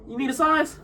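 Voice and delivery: high-pitched, hammed-up offer of help; mock eager stranger. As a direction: pipe up like an overkeen stranger. Random stranger offering a shoe size.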